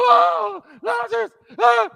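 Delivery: high, loud mock wails; crowd wailing. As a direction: imitate a crowd wailing for the dead man. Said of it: "And the crowd's coming out".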